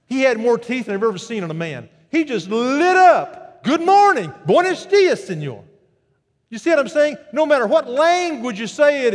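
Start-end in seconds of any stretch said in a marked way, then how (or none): 2.1-3.6 slow, high, loud; delighted. Real, not acted. Preacher relishing the attendant's delight. 3.6-5.6 very high loud greeting that drops at senor; delighted greeting. Retold or imitated. Attendant delighted at the reply, high and loud.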